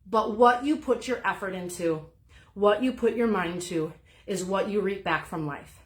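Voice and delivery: ordinary delivery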